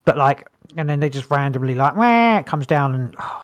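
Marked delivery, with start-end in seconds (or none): 1.9-2.4 high, short mock baby cry; imitated baby wail. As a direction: mimic a baby suddenly wailing. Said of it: "month old baby in the house".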